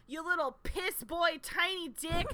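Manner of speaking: New York tough guy spoiling for a fight; hammed-up New York trash talk; mock-tough New York accent. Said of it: "why I'm doing this accent".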